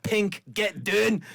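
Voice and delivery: high, drawn-out Scottish holler; mock Scottish shout, stretched out; Scottish accent. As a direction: imitate a Scottish heckler calling up, slowly. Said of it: "hearing a Scottish voice saying".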